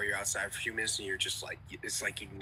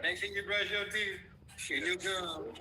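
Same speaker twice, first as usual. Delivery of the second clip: higher, playful take on her saying; imitating Tabby; 8 semitones higher than usual. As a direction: mimic a friend's motherly reminder. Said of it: "Tabby always say that".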